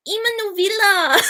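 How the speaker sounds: put-on high-pitched botched Australian accent; mock accent. Mimicking H two O for a friend, botched Australian accent. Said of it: "start being like".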